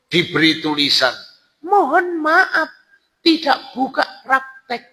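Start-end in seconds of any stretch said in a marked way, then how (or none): 1.6-4.8 measured falsetto notice; woman's voice. Acted. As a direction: read it out as the woman, high. Reads the woman's sign.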